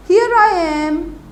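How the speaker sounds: high, loud shouted line; teacher shouts it out to show the exclamation; demo shout. Shown as a demonstration. Teacher demonstrating a shout to children, loud and high. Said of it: "when he's shouting".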